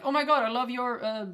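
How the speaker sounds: acted delighted outburst; mock fan gushing. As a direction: play an over-keen admirer. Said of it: "imagining a scenario".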